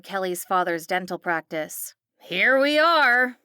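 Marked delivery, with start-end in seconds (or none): 2.2-3.4 slow, loud, gloating arrival; drawn-out announcement. A narrator's reading. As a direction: savour the moment. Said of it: "Christy said".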